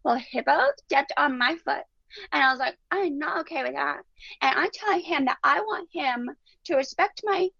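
put-on high, sweet toddler voice; a little girl chirping innocently; little three-year-old voice. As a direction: talk like a tiny girl explaining her game. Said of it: "all in this little three-year-old voice".

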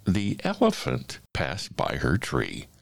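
ordinary delivery, nothing marked

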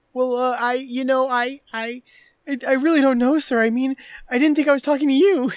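mock panic. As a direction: stammer out a nervous apology. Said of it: "He's, like, panicking".

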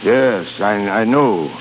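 low, halting admission; subdued admission. Acted. Boss answers his beaten rival, subdued and low. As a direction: let the voice sink as it dawns on you.

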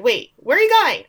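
high, loud urgent call; calling after someone. Retold or imitated. Mother calling after the girl, mock urgent. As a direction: imitate calling across a room.

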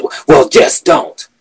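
high, slow mocking voice of the coworker. Coworker voiced in retold argument.

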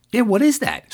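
high-pitched, astonished question; retold amazement. Kathleen answers about the strange lights, amazed. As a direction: imitate a baffled onlooker, pitch jumping up. Retells her baffled reaction to lights.

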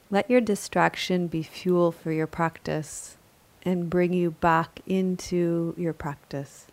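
calm, measured yoga nidra guidance; unhurried, even-toned relaxation voice; guided meditation. Acted. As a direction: guide a resting listener gently, with long pauses between phrases.

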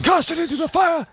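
high, dramatic elvish command; Hugo Weaving impression. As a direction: shout it like Elrond at Mount Doom. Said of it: "Hugo Weaving going".

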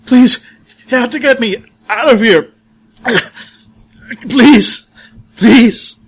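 high, loud desperate pleading; weak trapped cry for help. Acted. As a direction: beg to be pulled out, terrified. GM voices trapped lieutenant pleading.

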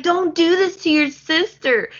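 high, mock scolding; lightly voiced guilt trip; mock Carter scolding. Carter guilt-tripping Paris, high and mock-scolding. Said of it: "he's like".

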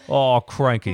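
laughing, bad Aussie "Oh, cranky"; bad Australian accent. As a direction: fumble a Crocodile Dundee line in Aussie. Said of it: "that's not it".

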